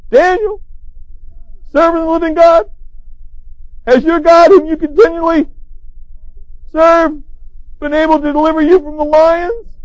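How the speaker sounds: high, pleading, grieving cry; lamenting call. Retold or imitated. King calls down to Daniel in the den, lamenting. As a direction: call out hoping against hope. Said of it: "With a lamenting voice to Daniel".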